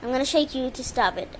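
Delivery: ordinary talk, nothing marked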